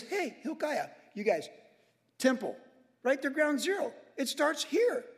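rally the priests, lightly voiced; king's command. Josiah to Hilkiah, a lightly voiced eager command. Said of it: "And he says".

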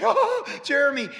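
loud, high, laughing cry of relief; relieved cry. Retold or imitated. Pastor retells calling out to Jeremy in joyful laughing relief. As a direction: burst out with joy. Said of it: "I was like".

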